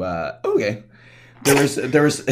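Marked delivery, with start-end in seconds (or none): 1.4-2.2 wrestler announcer voice. Shown as a demonstration. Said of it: "I have to use my, my wrestler announcer voice".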